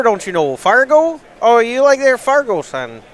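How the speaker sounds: put-on playful Upper Midwest North Dakota accent, a bit slow; teasing the co-host in a put-on North Dakota accent; mock North Dakota accent. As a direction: ham up a North Dakota drawl from Fargo. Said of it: "Is that a North Dakota accent?".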